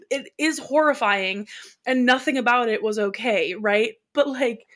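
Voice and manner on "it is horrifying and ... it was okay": normal talking voice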